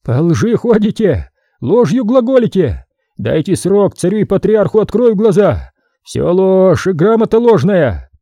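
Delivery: high, heated outcry; shouted accusation. A narrator's reading. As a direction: denounce them all as liars. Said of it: "закричал".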